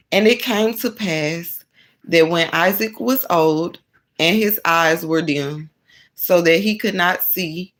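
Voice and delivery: loud, measured Bible reading; emphatic read-aloud of scripture. Acted. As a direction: read the verses out firmly, phrase by phrase with pauses. Reads scripture aloud to viewers.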